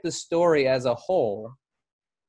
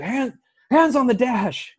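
higher, slow barked cop order; a cop at the window barking at a driver; cop command; 9 semitones higher, 4 dB louder than usual. Retold or imitated. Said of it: "and he's like".

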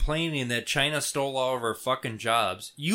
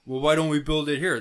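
ordinary talk, nothing marked

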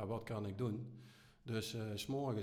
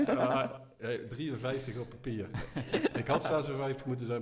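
stifled giggles; 3 semitones higher, 9 dB louder than usual. Real, not acted. Guest still laughing, answering age.

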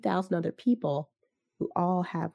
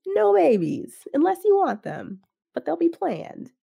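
excited pitch; 11 semitones higher, 3 dB louder than usual. Retold or imitated. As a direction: sell it with rising glee.

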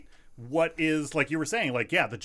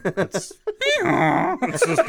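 laughing; 9 semitones higher, 5 dB louder, much slower than usual. Real, not acted.

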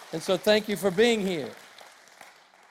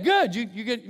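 ordinary talk, nothing marked

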